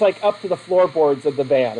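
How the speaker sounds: normal talking voice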